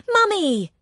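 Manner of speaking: put-on high, loud child's cry of joy; delighted yell. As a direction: shout it gleefully like a little boy. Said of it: "he yells in delight".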